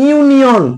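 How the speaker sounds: "ইউনিয়ন" starting on a clear "yu"; "ইউনিয়ন" pronounced as a teacher's model; opening "yu" sound. Shown as a demonstration. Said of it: "ইউ উচ্চারণে আসছে".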